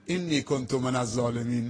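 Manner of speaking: ordinary talk, nothing marked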